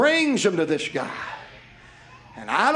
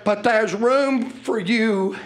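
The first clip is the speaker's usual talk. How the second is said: preaching invitation; 8 semitones higher, slower than usual. Acted. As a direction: call them in from the pulpit. Preaching at full force.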